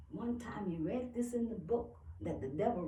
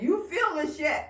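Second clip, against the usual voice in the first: high, louder taunting voice; insistent higher-pitched jab; nagging mind; 9 semitones higher, 6 dB louder than usual. Acted. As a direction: taunt from inside the head, pitched up. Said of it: "my mind said".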